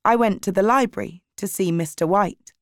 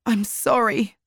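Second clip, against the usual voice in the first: mildly tearful apology; a touch of crying, lightly narrated; 3 semitones higher than usual. A narrator's reading. Frankie apologises to the teacher, lightly tearful. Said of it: "still crying".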